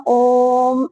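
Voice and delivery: ओम held long on the vowel, like a chant; drawn-out Om. Shown as a demonstration. Teacher showing how ओम is really said, prolonged chant-like ओम. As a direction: draw ओम out well past normal length. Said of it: "इस तरह से".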